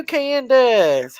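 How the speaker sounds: usual speaking voice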